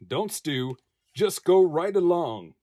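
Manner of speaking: plain reading voice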